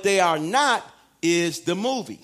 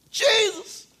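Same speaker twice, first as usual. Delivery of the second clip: high startled demon shriek; evil spirit cry; 11 semitones higher than usual. Acted. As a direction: shriek his name like a cornered spirit. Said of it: "evil spirits start calling out".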